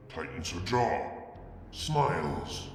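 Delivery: usual speaking voice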